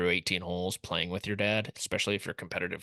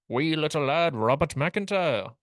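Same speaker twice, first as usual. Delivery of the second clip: higher, louder, drawn-out fake brogue sliding into Irish; Irish-tinged Scottish accent; 5 semitones higher, 4 dB louder than usual. Podcaster mock-announcing a golfer, botched Scottish-Irish accent. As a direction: attempt Scottish and land nearer Irish, stretching the name. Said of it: "That one felt more Irish".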